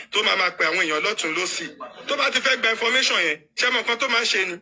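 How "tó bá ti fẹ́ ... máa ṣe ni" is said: normal talking voice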